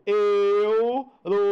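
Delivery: Europeu drawn out slowly, syllable by syllable; spelled-out europeu. Shown as a demonstration. Teacher slowly sounding out Europeu for students. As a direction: stretch Europeu out slowly, every sound clear. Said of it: "Aí você vê lá a palavra europeu".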